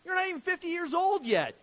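high, loud incredulous cry; hammed-up, outraged astonishment; mock disbelief. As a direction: balk like a baffled heckler. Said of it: "they're still back with".

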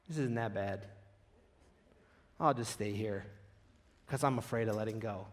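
low, soft acted retreat; timid resignation. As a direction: back down quietly, as if settling for the branch. Acts out timidly settling for the branch.

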